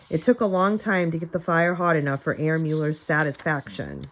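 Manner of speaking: ordinary delivery, nothing marked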